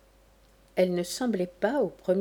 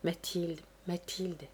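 soft, wistful murmur of a name; 8 dB quieter than usual. A narrator's reading. Chevalier sighing for Mathilde to himself, softly murmured. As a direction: murmur it softly, half to oneself. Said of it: "murmura le chevalier".

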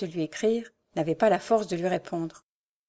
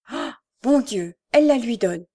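mild dismay, not truly hushed; horrified murmur; 3 semitones higher, 5 dB louder than usual. Acted. Marion seeing the letter handed over, horrified aside. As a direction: exclaim in alarm as an aside. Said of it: "murmura tout bas Marion, épouvantée".

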